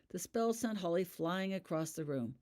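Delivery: ordinary delivery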